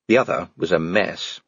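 ordinary delivery

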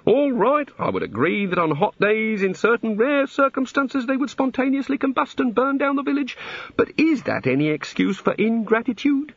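high-pitched, self-justifying rant; aggrieved god. A narrator's reading. God justifying himself over burnt villages, aggrieved. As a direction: argue your grievance, climbing and staying high.

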